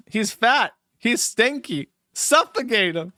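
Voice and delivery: high-pitched character impression. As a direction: pitch it up and push each short verdict. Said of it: "in P P P's voice".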